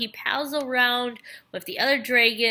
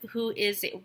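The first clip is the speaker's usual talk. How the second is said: ordinary talk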